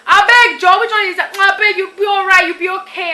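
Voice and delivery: high, loud, dismissive grandma brushing it off; imitating grandma. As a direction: laugh off a child's sadness, loud and high. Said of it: "She'll say".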